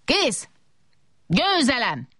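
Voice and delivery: high-pitched victory cry at ordinary volume; exclaiming. A narrator's reading. Big robot winning the dumpling contest, exclaiming triumphantly. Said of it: "kiáltotta".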